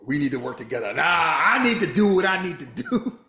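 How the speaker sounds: high, loud laughing mock. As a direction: laugh while mocking her. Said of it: "mocking her selfish play".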